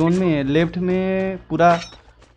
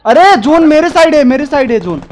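urgent call; 8 semitones higher, 6 dB louder than usual. Real, not acted. Urgent zone callout.

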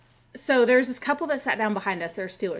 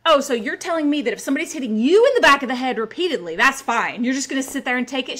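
louder, higher incredulous challenge; indignant comeback; 4 semitones higher, 5 dB louder than usual. Retold or imitated. Hit woman challenges rude fan, indignant comeback. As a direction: re-enact throwing it back at her, leaning on YOU. Sarcastic comeback in retold quarrel.

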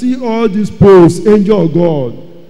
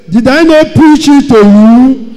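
louder, higher challenge; bold reproach; 5 semitones higher, 6 dB louder than usual. Retold or imitated. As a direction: confront the sinner boldly. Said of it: "Pastor will ask you with boldness".

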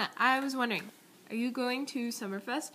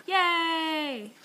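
high loud yay; bright loud celebratory yay; cheer; 6 semitones higher, 8 dB louder than usual. Real, not acted. Cheering finished birthday picture.

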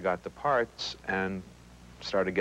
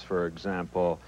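ordinary talk

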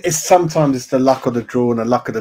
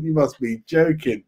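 normal talking voice

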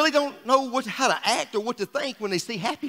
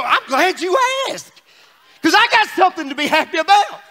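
glad shout; 6 semitones higher, 5 dB louder than usual. Retold or imitated. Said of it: "That just says".

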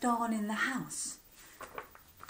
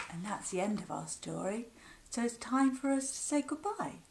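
ordinary delivery

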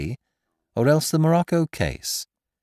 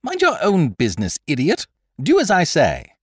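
higher, louder impatient snap; losing patience; 11 semitones higher, 5 dB louder than usual. Acted. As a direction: order the fool to obey now. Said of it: "He lost patience".

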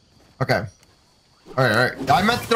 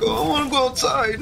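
high whiny imitation of the dog; 9 semitones higher than usual. Dad mimicking the crying dog in a high whine. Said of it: "He keeps crying. He's like".

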